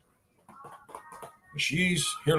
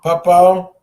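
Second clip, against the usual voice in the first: calling out; 11 dB louder than usual. Acted. Calling to baby.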